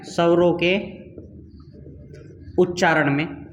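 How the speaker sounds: each word spaced out with long pauses for writing; word by word, like dictating to a class; slow dictation. Shown as a demonstration. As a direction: dictate it slowly so students can copy. Teacher dictating notes to students.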